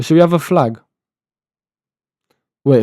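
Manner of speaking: usual speaking voice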